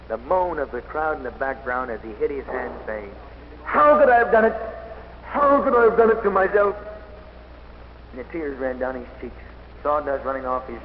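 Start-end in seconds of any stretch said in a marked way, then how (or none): 3.6-6.7 high, loud anguished outcry; retold cry of self-reproach, high and loud. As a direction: cry out in despair at yourself.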